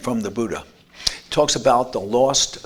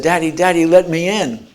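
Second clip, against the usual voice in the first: put-on higher, louder child calling at the door; a small boy begging to be let in; little son calling; 5 semitones higher, 6 dB louder than usual. Son knocking on his father's door, calling out.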